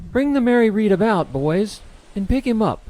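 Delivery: loud, high captain's command; shouted order. A narrator's reading. Captain orders crew to rescue the man, shouting. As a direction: call it out to all hands. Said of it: "she ordered".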